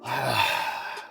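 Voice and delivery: long frustrated exhale; exasperated sigh; heavy sigh. Real, not acted. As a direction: let out a tired sigh.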